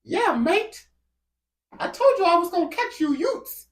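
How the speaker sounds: put-on very high-pitched British accent; hammed-up British street threat; mock British attacker. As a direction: squeal it as a menacing Brit. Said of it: "walked down by a British person".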